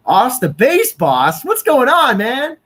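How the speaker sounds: delighted shout-out to a viewer; excited greeting. Real, not acted.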